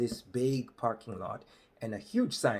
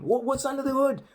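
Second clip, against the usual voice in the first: eager local; 13 semitones higher than usual. Retold or imitated. Locals quizzing about the truck.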